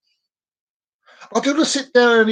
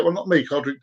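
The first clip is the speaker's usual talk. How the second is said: usual speaking voice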